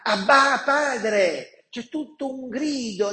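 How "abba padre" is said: loud high cry of Abba Padre. Acted. The spirit within us crying to the Father, loud. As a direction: call it out, higher and louder. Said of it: "lo spirito dentro di noi grida".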